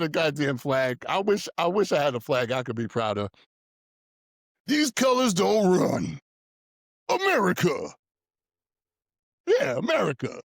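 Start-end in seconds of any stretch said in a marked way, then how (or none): 4.7-7.9 mocking flag-waving American pride; mock patriot. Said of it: "They love that one". 9.5-10.4 mock patriot. Mocking patriots after slogan.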